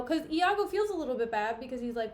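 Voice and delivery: ordinary talk, nothing marked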